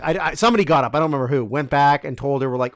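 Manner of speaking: ordinary talk, nothing marked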